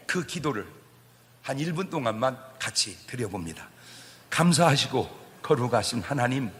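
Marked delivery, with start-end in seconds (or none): 4.3-6.5 fervent prayer. Acted. Pastor praying aloud with the congregation, building fervent prayer. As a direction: pray it, swelling to a cry by the end.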